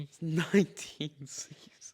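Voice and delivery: whispered through a laugh; breathy laugh on the words. Retold or imitated. Retelling shock at the show's length.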